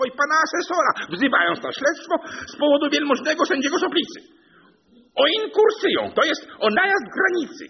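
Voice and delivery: high, loud, rattling legal announcement; piercing official declaration at full volume; court crier's proclamation. A narrator's reading. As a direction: proclaim it shrilly like an officious bailiff. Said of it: "Protazy zawołał trzykroć".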